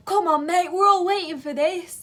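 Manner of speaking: loud, high mocking customer; mock impatient customer demand. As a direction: bark it like a pushy customer.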